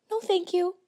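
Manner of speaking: high, soft, sickly sweet thanks; sweet Utah girl voice. Retold or imitated. Declining a ride from the man in the sports car, sickly sweet. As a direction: sound like a Disney princess. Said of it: "in my perfect little stay sweet accent".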